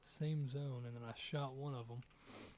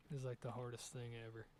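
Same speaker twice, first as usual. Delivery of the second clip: usual speaking voice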